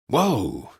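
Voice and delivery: exclaiming. A narrator's reading. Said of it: "Draco exclaimed".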